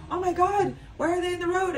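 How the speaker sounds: high-pitched retold alarm; alarmed friend. Friend spotting two coyotes on a hike, high alarm.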